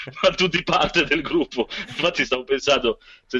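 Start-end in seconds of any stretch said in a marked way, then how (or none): 0.1-1.7 laughing. Real, not acted. Joking about listeners in group.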